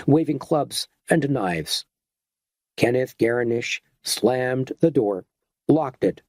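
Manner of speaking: ordinary delivery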